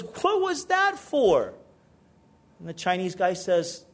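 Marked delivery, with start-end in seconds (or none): 0.0-1.5 much higher, slow, stunned question; shocked indignant squeal; outraged what-was-that-for. Acted. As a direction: demand why you got punched.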